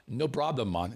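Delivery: put-on a light, easygoing Jamaican accent repeated. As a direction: savour your Jamaican accent. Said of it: "that's my Jamaican accent".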